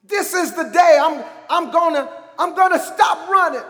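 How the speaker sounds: high, emphatic preaching cry; forceful declaration. Shown as a demonstration. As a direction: proclaim it loudly, repeating for force.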